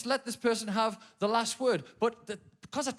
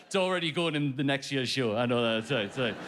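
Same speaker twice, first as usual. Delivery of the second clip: sheepish apology; 7 semitones lower than usual. Retold or imitated. Apologising while being told off.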